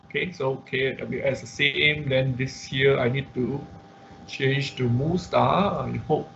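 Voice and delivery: ordinary talk, nothing marked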